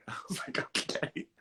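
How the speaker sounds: hushed unvoiced okay; whisper. A son answering a stranger's cryptic gift note, whispered. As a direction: breathe it out, baffled.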